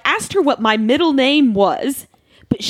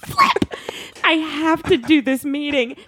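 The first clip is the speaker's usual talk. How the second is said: exasperated outburst; 2 semitones higher than usual. Retold or imitated. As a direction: yelp it in laughing panic. Panicking before a work meeting.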